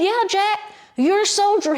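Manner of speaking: high, emphatic encouragement; storyteller's rallying bear. Retold or imitated. Bear tells little boy he is a soldier, high rallying voice. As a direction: urge him on, pitch way up.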